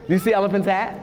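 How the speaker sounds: ordinary talk, nothing marked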